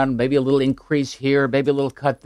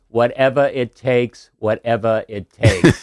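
Kennedy accent impression; slower than usual. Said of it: "You do the accent well".

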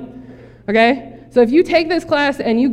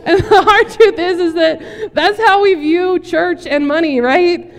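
talking through real laughter, high and loud; laughing; 7 semitones higher, 5 dB louder, slower than usual. Real, not acted. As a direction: push the words out while laughing. Still laughing after the joke.

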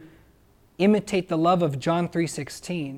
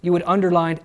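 usual speaking voice

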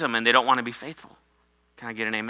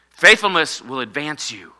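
usual speaking voice

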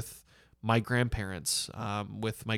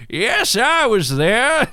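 put-on high, slow old-folks voice, loud and drawn out; elderly person; 11 semitones higher, 8 dB louder, much slower than usual. Old survivor answering about the great pandemic, hammed-up elderly voice. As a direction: play an elder recalling it, slowly and loudly. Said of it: "I can't even do my old elderly accent".

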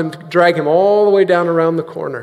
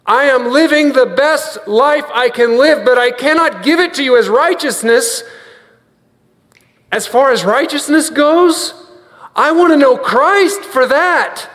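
higher-pitched, impassioned preaching; as if newly discovered; 7 semitones higher than usual. Acted. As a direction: build to urgent, faster declarations of longing. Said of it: "as if we are hearing it for the first time".

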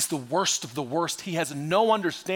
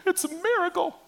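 mock amazement. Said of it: "you're like".

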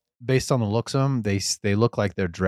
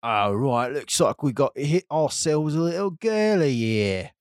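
thug voice; 7 semitones higher than usual. Acted. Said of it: "he pounds his fists together and he says".

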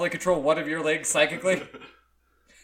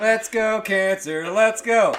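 mock cheer; 4 semitones higher, 6 dB louder, much slower than usual. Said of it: "That's you right now".

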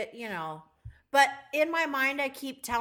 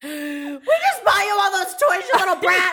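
high, loud hammed-up scolding; mock parental shout; 9 semitones higher, 13 dB louder than usual. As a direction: bellow at a cheeky kid, played for laughs. Imagined parent scolding rude child.

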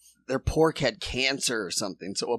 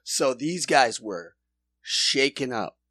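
ordinary talk, nothing marked

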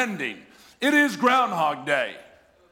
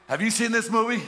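higher and laughing teasing to his wife; laughing mock question; 6 semitones higher, 3 dB louder than usual. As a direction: tease the wife through a laugh. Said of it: "what do I say?".

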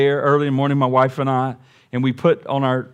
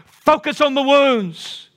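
much higher, slow, ringing preacher cry; preaching at full force; 11 semitones higher, slower than usual. Shown as a demonstration. Preacher urging the church to focus on the wounds, full preaching cry. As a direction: lift it up and stretch it out, preaching.

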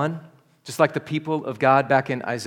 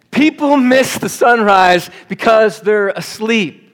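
louder, higher, slower restatement; emphatic repeat; 7 semitones higher, 8 dB louder, slower than usual. Shown as a demonstration. Pastor repeats his point to a yawning crowd, loud and slow. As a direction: drive the point home to the congregation.

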